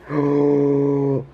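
sharp gasp of delight; surprised gasp. Real, not acted. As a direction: gasp at the pull. Surprised by rare card pull.

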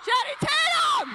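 high, loud, delighted; excited shout. Real, not acted. Speaker repeating a girl's answer, excited and loud. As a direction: cheer the name out.

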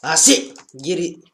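loud, high barked order at the cow; shouted command. Real, not acted. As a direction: yell at the runaway cow to get back. Yelling at runaway cow.

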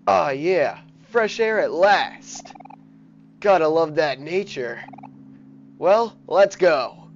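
character voice. Acted. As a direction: savor the fresh air out loud. Voicing character in game.

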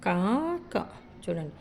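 ordinary delivery

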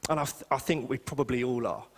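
ordinary delivery

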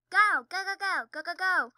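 mock shouts, high and loud; imitated urgent shouting. Retelling a video for viewers, imitated urgent shout. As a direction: imitate frantic urging from a video. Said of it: "I'll tell you what the video says".

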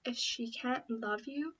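ordinary delivery

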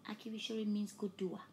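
ordinary talk

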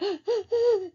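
put-on sharp high gasp like a sobbing baby; imitated gasp. Shown as a demonstration. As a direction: gasp in as if out of breath from crying. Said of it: "and then they gasp for air".

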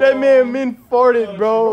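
shouting while laughing; loud shout. Real, not acted. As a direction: yell it out. Shouting about the fart.

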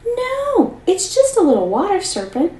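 sudden high pleading no; urgent cry. Acted. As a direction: jump in high to stop her sister. Seven stops sister killing serpent.

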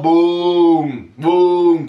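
loud imitated crash sounds; loud booms. Mimicking the blow of reality to the team with loud booms. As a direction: slam each boom like an impact.

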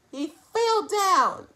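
usual speaking voice

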